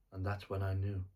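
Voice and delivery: normal talking voice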